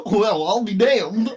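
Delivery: goofy bumpkin. Acted. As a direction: stretch it like a dopey country boy. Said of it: "go full goofy".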